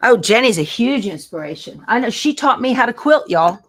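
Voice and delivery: ordinary talk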